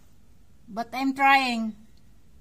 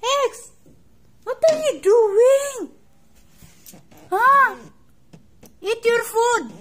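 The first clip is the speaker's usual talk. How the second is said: high, loud pet talk; loud high-pitched owner scolding cat; coaxing the cat; 7 semitones higher, 8 dB louder than usual. Real, not acted.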